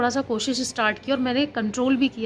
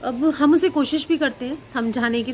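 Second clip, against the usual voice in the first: usual speaking voice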